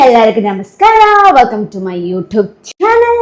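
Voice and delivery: sing-song channel welcome with drawn-out endings; sing-song YouTuber opener; vlog intro greeting. Real, not acted. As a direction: greet the viewers loudly, stretching the last words.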